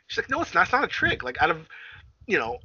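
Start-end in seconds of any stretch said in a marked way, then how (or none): 0.3-1.2 insisting. Retold or imitated. Character voiced in retelling.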